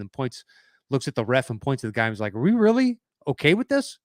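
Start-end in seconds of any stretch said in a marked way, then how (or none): 2.3-4.0 high-pitched, rising mock disbelief; incredulous questioning. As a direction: act out an incredulous appeal to the ref. Voices Westbrook's disbelief to referee.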